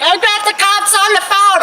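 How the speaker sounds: very high panicked bystander; high-pitched mock hysteria; freaking out. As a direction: imitate a panicked shriek from across the lot. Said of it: "was already freaking out".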